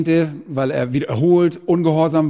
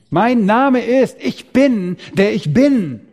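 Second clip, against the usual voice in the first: proclaiming the name; 6 semitones higher, slower than usual. Acted. God naming himself.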